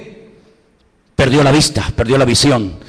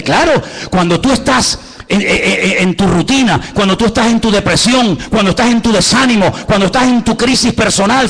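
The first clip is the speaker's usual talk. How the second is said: higher, driving sermon cadence; preaching at full force; 5 semitones higher than usual. Acted. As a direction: preach it with fire, lifting the church. Sermon climax on God's vision. (predicación a plena voz, con fervor)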